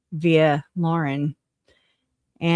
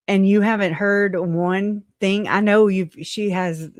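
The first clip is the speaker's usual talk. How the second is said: ordinary talk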